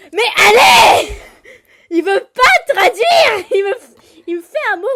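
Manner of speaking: laughing exasperated yell; frustrated shout. Real, not acted. Frustrated at game translation.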